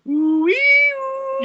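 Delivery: very high, loud sung sting; cliffhanger sting. Retold or imitated. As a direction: croon the spooky theme sting. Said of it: "and it goes".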